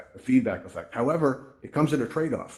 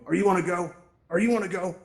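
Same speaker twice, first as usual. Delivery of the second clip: higher, acted nosy question; pointed questioning; 7 semitones higher than usual. Strangers accosting a new user at the campfire, pointedly questioning. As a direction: imitate strangers putting someone on the spot.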